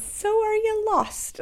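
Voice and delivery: high, slowed mock question from a motorcycle cop; police officer quote. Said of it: "he pulls up and he goes".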